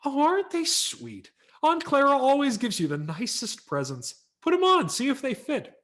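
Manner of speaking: high, loud, sugary mother's voice; gushing mother. Acted. Mother urging her son to try slippers, gushing high. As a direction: coo like a delighted mom. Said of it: "My mother ... by saying".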